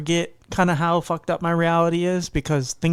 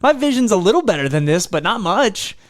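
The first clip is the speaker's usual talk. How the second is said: surprised realization; 5 semitones higher, 5 dB louder than usual. Retold or imitated. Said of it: "I'm like".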